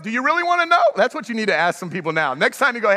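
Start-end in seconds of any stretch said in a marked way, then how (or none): 0.0-0.8 high-pitched, insistent question; worked-up challenge. Acted. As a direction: fire the question back, all worked up. Acted challenge after venting.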